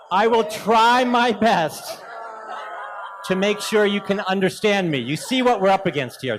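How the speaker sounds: high, loud, slowed, laughing through the words; laughing hard at his own joke. Real, not acted. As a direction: laugh while promising to try.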